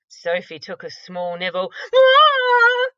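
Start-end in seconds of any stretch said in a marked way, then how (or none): 1.9-2.9 very high, loud shriek of disgust; high disgusted cry; disgusted splutter. Acted. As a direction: yelp at a horrid taste. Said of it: "she spluttered".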